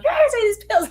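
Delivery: high, loud forcing command; mock angry shout. Mom retelling forcing pills on son, high loud shout. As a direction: bark it like an exasperated mom.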